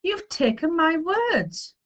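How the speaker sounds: high, slow accusing cry; wronged child crying out; upset cry. A narrator's reading. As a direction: draw out the accusation, pitched high. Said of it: "he cried".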